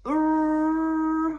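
loud, high imitated school bell ring. Teacher reading story, imitating the school bell. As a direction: trill it like the end-of-day bell. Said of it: "that's the bell going off".

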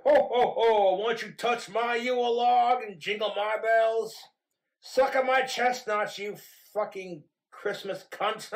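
loud, high-pitched mock Santa; crude Santa. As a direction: boom it out like a lewd Santa.

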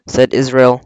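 usual speaking voice

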